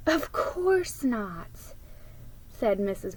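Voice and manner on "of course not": very slow gentle mother; reassuring. Acted. Mrs McIntyre reassuring Brad, very slow and gentle. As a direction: soothe him softly.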